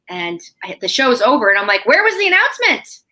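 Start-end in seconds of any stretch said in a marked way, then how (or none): 1.9-3.0 very high, loud exasperated demand; squeaky mock-indignant outburst; retold indignant question. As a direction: act out baffled outrage, pitch shooting up. Retells frustrated reaction after show.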